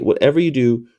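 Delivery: ordinary talk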